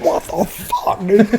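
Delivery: slow, strained, voiceless curse; acting out a struggle, words squeezed out; straining. Fighting a zip tie on the ammo can, straining.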